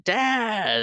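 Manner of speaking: high, loud mock cry of Dad; yelling for dad. Daughter calling for her dad in danger, high yell. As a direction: call out to her father. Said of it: "she's just yelling".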